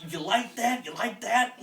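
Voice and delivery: high teasing "you like that" voice; mocking lover's taunt; mock bedroom taunt. Mock sex partner taunt.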